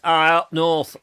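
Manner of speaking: a light mock northern English accent on "up north". Host teasing Robert's accent, mock northern English voice. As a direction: tease a friend with a quick northern English accent.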